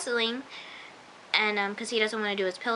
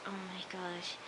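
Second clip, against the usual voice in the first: very soft aside; hushed dismay; 3 semitones lower, 15 dB quieter, slower than usual. Retold or imitated. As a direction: say it under the breath. Her hushed reaction to show.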